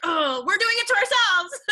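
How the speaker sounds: mock exclamation ending in laughter; laughing exclamation. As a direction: burst out with a laughing realisation. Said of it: "I'm like".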